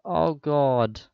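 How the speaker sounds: normal talking voice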